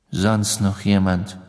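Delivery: slow, drawn-out question; measured questioning. Acted. Sirius asks who else sat there, measured. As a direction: ask it slowly, unruffled.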